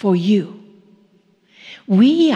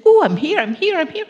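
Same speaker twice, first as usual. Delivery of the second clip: happy dance cheer; 5 semitones higher, faster than usual. Shown as a demonstration. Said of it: "do the happy dance".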